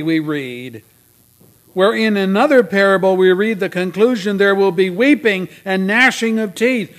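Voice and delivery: loud, emphatic pulpit delivery; forceful preaching. Real, not acted. Preacher warns the congregation through the parable of judgment, forceful preaching. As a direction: drive the warning home to the congregation.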